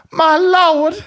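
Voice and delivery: high, loud "My lord" in mock joy; playing the overwhelmed airport bar lady, high and loud; imitating the cheery lady. Airport bar lady thanking him, mock overjoyed and high. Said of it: "she's just like".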